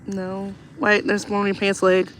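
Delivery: ordinary talk, nothing marked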